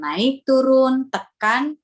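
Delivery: NAIK, TURUN, TEKAN stretched out slowly and loud; slow, emphatic demo of "naik, turun, tekan" with moving pitch; played-up intonation. Shown as a demonstration. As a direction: act out the rise and fall on "naik, turun", then press "tekan". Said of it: "Intonasinya dimainkan".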